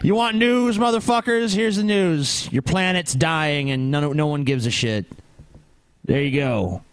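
ranting newscaster. Pretend. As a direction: yell the news at America. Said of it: "We're just like".

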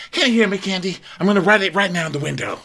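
high, rapid mock calling. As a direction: imitate someone hollering for attention, rushing on. Mimics Sheree calling to Candy.

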